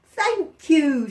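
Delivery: a bright little thank-you from the fox; fox's thanks. Acted.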